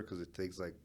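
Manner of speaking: ordinary delivery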